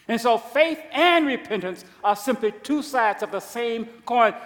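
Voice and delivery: the saying restated with full preaching force; emphatic restatement. Real, not acted. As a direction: drive the point home loudly. Restated louder as a conclusion.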